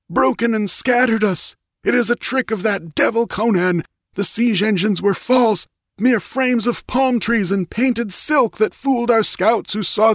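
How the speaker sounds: high, frantic battle report; yelling. A narrator's reading. Wounded priest tells the queen of the rout, yelling. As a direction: keep it pitched high and desperate throughout. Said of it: "yelled the priest".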